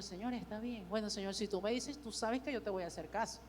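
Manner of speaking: a hushed, humble question to God; murmured prayer, asking if it's right; quiet prayer. Retold or imitated.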